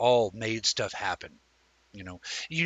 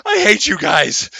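exasperated yell; 9 semitones higher, 14 dB louder than usual. Retold or imitated. Fed up after prank.

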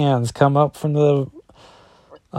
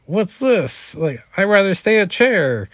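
much higher, bewildered ghost voices; confused prisoner souls; 8 semitones higher than usual. Acted. Freed prisoner souls complaining about leaving the chair, higher bewildered voice. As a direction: sound puzzled at being freed. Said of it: "prisoners' voices are like".

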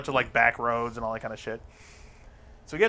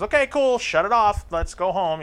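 high, lightly voiced girl; mock girl wanting to leave; 7 semitones higher, 4 dB louder than usual. The girl, wanting to stop, says it in a high mock voice. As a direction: put on her higher voice, lightly.

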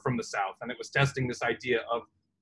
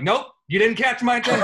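laughing dismissal; 8 semitones higher, 5 dB louder, faster than usual. Retold or imitated. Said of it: "where you're like".

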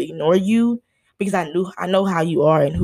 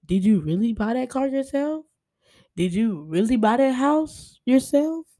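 suspicious questioning; 5 semitones higher than usual. Retold or imitated. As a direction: grill them like a suspicious detective. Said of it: "I'm just like".

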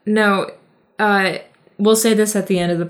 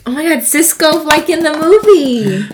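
excited realisation; 8 semitones higher, 9 dB louder than usual. Real, not acted. Sudden realisation about dead dog.

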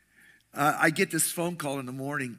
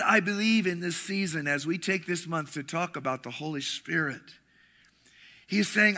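ordinary delivery

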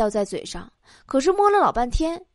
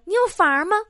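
woman on blind date; 5 semitones higher than usual. Acted. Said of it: "女的问".

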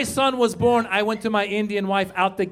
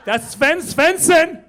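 slow, higher, louder awestruck gasp; starstruck kid; 6 semitones higher, 5 dB louder, slower than usual. Retold or imitated. Son spots a famous influencer in the street, awestruck. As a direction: gush over the influencer. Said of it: "he's like".

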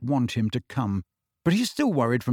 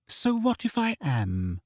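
slow, cool and offhand, voiced higher; 6 semitones higher, 4 dB quieter, slower than usual. A narrator's reading. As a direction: shrug it off coolly, slowly. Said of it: "said Hermione coolly".

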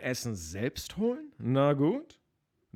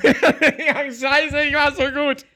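breaking into laughter, louder and higher; cracking up mid-line; 12 semitones higher, 11 dB louder, slower than usual. Real, not acted. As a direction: lose it laughing mid-sentence. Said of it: "ich musste lachen".

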